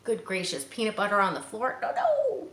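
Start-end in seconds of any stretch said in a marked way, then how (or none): 1.8-2.5 mock horror. Reader reacts in mock horror.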